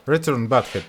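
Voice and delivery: ordinary delivery, nothing marked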